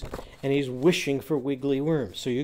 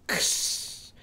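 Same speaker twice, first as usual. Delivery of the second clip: relaxed exhale; 4 dB quieter than usual. Shown as a demonstration. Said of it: "relax by exhaling and going".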